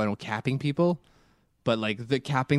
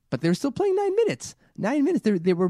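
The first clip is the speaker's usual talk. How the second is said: indignant disbelief; 11 semitones higher, 4 dB louder than usual. Real, not acted. Outraged at nine minutes.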